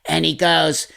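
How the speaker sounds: ordinary talk, nothing marked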